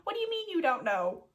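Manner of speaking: high, sneering imitation of the professor; mocking the professor who asked about the cylinder. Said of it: "fuck that dude who said".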